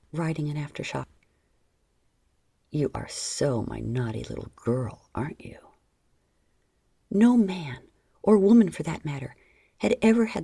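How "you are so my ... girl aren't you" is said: teasing lover. Acted. John teasing his lover.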